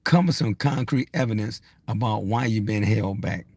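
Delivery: normal talking voice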